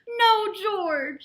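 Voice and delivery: a mother's high, loud firm no; loud scolding no. A narrator's reading. Mother correcting George, loud and high. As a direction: stop him with a loud no.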